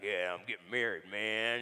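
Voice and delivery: stoere cowboy, lager, nonchalant. Retold or imitated. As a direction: speel de stoere bruidegom met lage stem. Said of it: "He's all tough".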